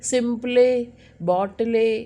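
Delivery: सिंपले, बॉटले with the silent E voiced as a clear "lay"; sounded final E. Shown as a demonstration. Teacher shows students a wrong reading, final E of सिंपले, बॉटले pronounced. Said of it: "ई का sound".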